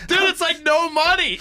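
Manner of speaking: high laugh through words. Real, not acted. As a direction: laugh as you say it. Laughing at price.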